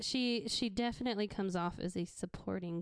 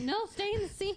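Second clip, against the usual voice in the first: director telling off; 11 semitones higher than usual. Retold or imitated.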